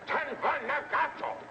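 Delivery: high, urgent call; excited call. Acted. Alien repeats it, calling out.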